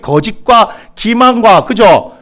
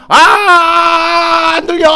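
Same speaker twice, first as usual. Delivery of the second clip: mock shout; 8 semitones higher, much slower than usual. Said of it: "이렇게".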